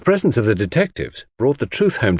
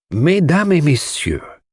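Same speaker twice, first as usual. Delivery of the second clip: slow, grand opening of a lecture; bow and address the room like a celebrity; lecturer's address; 5 semitones higher, slower than usual. Acted. Poirot opening the proceedings to the household, slow and grand. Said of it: "as though he were a celebrity about to deliver a lecture".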